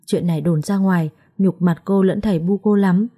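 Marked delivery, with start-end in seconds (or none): none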